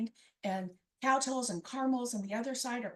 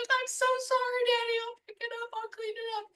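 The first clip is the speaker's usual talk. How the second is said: put-on childlike apology; 10 semitones higher, 3 dB louder than usual. Child apologizing to dad, mock pleading.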